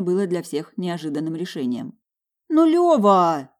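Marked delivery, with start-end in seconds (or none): 2.5-3.5 higher, louder shriek of pain; squeal. Acted. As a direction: yelp at the bite. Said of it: "взвизгнула я".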